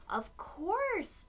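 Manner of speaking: a high, light "of course"; lightly voiced Dad agreeing; Dad reassuring. Dad answering Aiden, lightly and cheerfully. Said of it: "Dad said".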